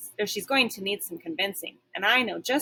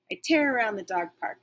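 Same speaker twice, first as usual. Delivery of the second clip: ordinary delivery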